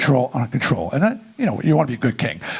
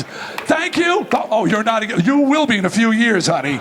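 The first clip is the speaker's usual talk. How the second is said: normal talking voice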